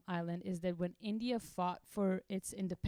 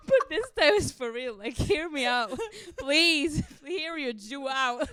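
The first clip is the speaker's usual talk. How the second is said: higher, louder, laughing mock prayer; laughing plea; 7 semitones higher, 10 dB louder than usual. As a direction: beg God while cracking up.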